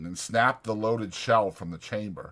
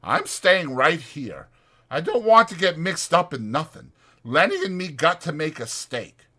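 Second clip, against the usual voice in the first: higher, louder rough ranch hand; 7 semitones higher, 4 dB louder than usual. Acted. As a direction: speak it like a bunkhouse ranch hand. Voicing George.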